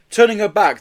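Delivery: ordinary delivery, nothing marked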